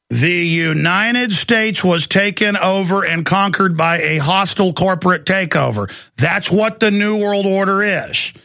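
slow, deliberate, each word spaced out; very slowly. Real, not acted. Host to listeners, slowing right down to hammer it home. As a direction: spell it out slowly so it sinks in. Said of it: "I'm gonna say it again very slowly".

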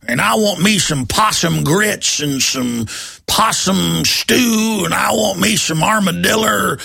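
mock good old Texas boy, ham it up. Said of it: "I know how to talk like a Texan boy".

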